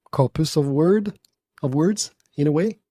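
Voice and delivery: usual speaking voice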